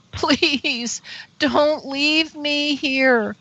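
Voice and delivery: high, very slow, drawn-out begging; pleading. Retold or imitated. Woman begging the craft before it goes, pleading. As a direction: stretch every word into a plea.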